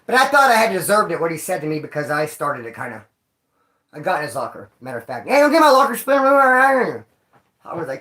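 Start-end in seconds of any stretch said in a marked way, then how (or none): 5.3-7.1 higher, louder snippy complaint; snippy inmate voice. Retold or imitated. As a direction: snap at him like an annoyed cellmate. Voicing the annoyed inmate.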